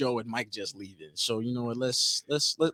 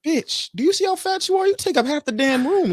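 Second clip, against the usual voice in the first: high-pitched comic indignation; exasperated inner retort; 3 dB louder than usual. Retold or imitated. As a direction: act out the outraged comeback, pitched way up. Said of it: "In my head, I'm like".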